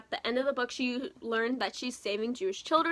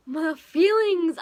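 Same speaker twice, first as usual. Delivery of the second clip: overwhelmed gush; 9 semitones higher, 7 dB louder, much slower than usual. Real, not acted. Overwhelmed reaction to book ending.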